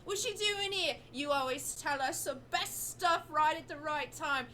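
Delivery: put-on high, loud, greedy street kid; eager urchin voice. Orphan Ollie begs the crew for free grabbies, high and eager. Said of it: "gives you a wink and says".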